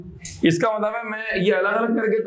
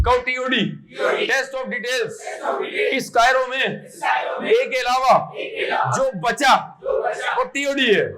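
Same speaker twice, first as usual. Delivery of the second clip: emphatic teacher shout; 4 semitones higher, slower than usual. Acted. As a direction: drill it home with a raised, ringing voice. Teacher drilling the term to class.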